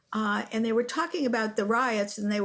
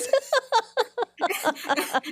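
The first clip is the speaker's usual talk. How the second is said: real burst of laughter; 12 semitones higher, 3 dB louder than usual. Real, not acted. Laughing at comedian's intro.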